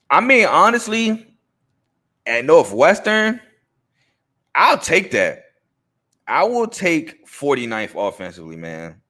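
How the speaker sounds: fired up, taking that rank gladly; excited. Real, not acted. Analyst hyped about Northwestern's offense ranking, excited.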